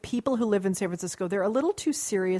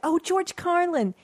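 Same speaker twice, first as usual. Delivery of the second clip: clueless fan; 12 semitones higher, 6 dB louder than usual. Retold or imitated. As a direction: play the dim fan who only knows the old bits. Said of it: "they're like".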